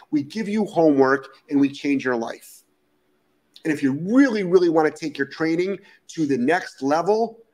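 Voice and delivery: normal talking voice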